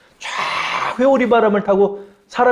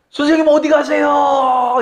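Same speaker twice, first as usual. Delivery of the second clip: high, slow call after a departing teacher; shouting after teacher; 8 semitones higher, 3 dB louder, slower than usual. Acted. Disciple shouting after his rising teacher, high and slow. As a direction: cry out to the master in the sky. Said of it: "이렇게 소리를 질러요".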